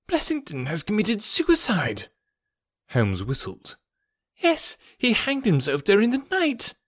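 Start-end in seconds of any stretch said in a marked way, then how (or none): 0.1-2.1 high, trembling doctor's voice; a frightened physician breaking bad news; shaken doctor. 4.4-6.7 high, trembling doctor's voice; a frightened physician breaking bad news; shaken doctor.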